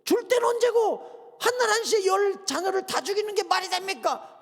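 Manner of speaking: high, pushed-out grievance; indignant protest. Pretend. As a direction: demand an answer from God, voice up. Imagined complaint to God. (억울해서 하나님께 높은 목소리로 따짐)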